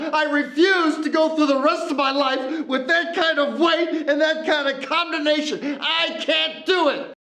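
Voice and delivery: high, strained, near breaking into a cry; pleading he cannot carry the guilt; anguished outcry. Acted. Man refusing forgiveness, anguished high outcry.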